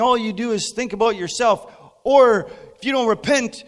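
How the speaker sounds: high, loud, hammed-up hellfire sermon; mock fire-and-brimstone preaching; brimstone preacher. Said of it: "really turning into a brimstone kind of one".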